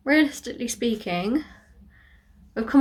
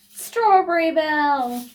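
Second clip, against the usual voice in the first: high, loud, slow transformation shout; magical girl call; 6 semitones higher, 7 dB louder, slower than usual. Pretend. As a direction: announce it like an anime heroine.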